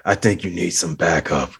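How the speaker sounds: no-joke police officer line, mildly imitated; serious cop impression. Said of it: "he just came off, like, very serious".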